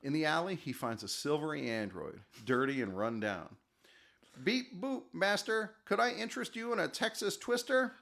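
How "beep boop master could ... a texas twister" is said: put-on high beeping robot with an accent; polite butler robot. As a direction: sell it like an eager robot servant. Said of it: "He's developed an accent".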